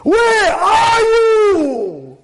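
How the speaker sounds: high, slow, drawn-out shout; flipping out. Acted. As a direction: stretch out a furious yell. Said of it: "about to flip out on his wife".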